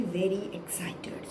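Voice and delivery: whispered with excitement. Acted. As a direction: breathe it out as a secret.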